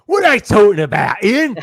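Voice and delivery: loud, high mock shout; Carrie. Co-host imagines her calling to Ian, hammed-up shout. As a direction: yell it in a put-on, baffled voice. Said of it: "Carrie's going to Ian".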